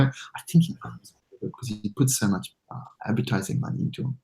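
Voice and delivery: hushed aside. Real, not acted. Dropping voice, aside.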